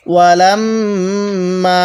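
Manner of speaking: slow chanted tajweed recitation; Quranic recitation. Shown as a demonstration. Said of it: "Tajdid গুণনা করা ওয়াজিব". (ধীর তিলাওয়াতের সুরে)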